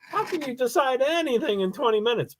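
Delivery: high disbelieving question; high, incredulous quote. Retold or imitated. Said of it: "and I'm like".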